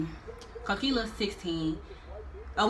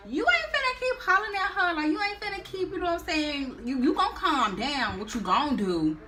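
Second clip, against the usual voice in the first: higher, pretend-threatening snap; mock tough talk; 9 semitones higher than usual. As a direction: fake getting in his face, playfully. No, I'm just playing.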